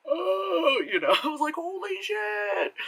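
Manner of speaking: high, stunned gasp of disbelief; shocked reaction. Retold or imitated. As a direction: react like the twist just hit you. Reacting to the big reveal.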